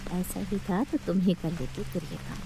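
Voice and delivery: muttered aside. Acted. Narrator's under-breath retort.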